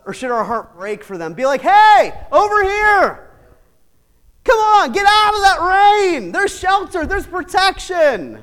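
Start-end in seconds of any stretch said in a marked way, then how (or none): 1.6-8.4 loud, much higher call across the rain. Pretend. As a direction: wave them in from the storm, shouting. Calling someone in from rain.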